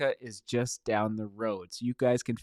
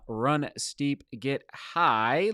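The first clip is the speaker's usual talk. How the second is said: slow motto; much slower than usual. Real, not acted. Repeating motto slowly.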